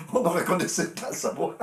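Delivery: high-pitched, laughing disbelief. Real, not acted. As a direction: tell it through real laughter. Laughing over first hearing a recording.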